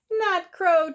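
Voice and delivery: put-on a kid yelling with joy at birds; excited shout. A narrator's reading. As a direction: shout it out to the sky. Child spotting birds, shouting.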